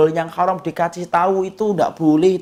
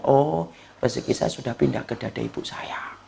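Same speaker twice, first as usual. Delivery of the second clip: soft, unvoiced whisper, lower and softer; whispered baby thought; 6 semitones lower, 6 dB quieter than usual. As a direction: whisper it as a content newborn. Baby's relieved thought, whispered.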